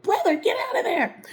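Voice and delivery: high, loud, half-crying plea; frantic call. Retold or imitated. Calling her church brother away from the fire, frantic high cry. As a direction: cry out to him to get away.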